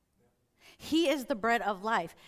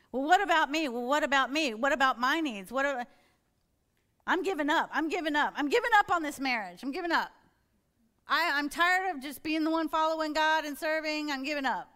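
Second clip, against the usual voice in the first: louder, higher, indignant self-pity; frustrated outburst; 5 semitones higher, 4 dB louder than usual. Acted. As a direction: vent like you're fed up with serving. Acting out a self-pitying complaint.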